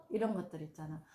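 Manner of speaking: normal talking voice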